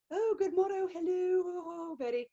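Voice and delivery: high, plummy upper-class British put-on; mock the upper-class Queen's English. Shown as a demonstration. Historian showing how BBC dramas do royals, exaggerated upper-class British accent. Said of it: "They use the upper class, you know, Queen".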